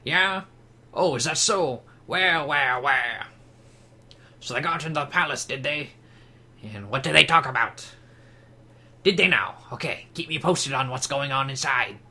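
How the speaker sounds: scheming cartoon villain voice; Waluigi. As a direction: question like a sly, emphatic cartoon schemer. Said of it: "Let's give him Waluigi voice".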